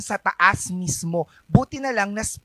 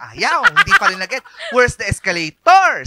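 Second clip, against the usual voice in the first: laughing loudly at her own failed request; exasperated laughing retelling; 4 semitones higher, 8 dB louder than usual. Real, not acted. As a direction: laugh through the words, louder and higher.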